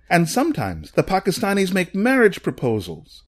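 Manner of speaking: high-pitched aggrieved woman's voice; abandoned mother's plaintive, heightened testimony; distraught woman. A narrator's reading. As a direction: pour out the grievance, high and pained. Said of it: "A woman standing behind him then said".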